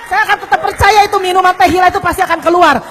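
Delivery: ordinary talk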